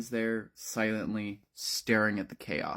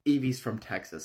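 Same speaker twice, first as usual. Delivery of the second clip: ordinary delivery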